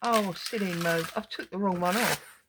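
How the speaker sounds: ordinary talk, nothing marked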